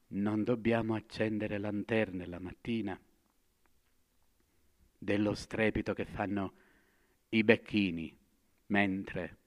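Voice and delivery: soft and low; hushed. Acted. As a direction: drop almost to a murmur. Madman falls to a hush.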